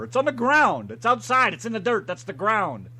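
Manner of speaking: high, loud insistent retort; exasperated correction. Retold or imitated. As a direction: hammer each short phrase home in disbelief. Retelling exasperated argument over wording.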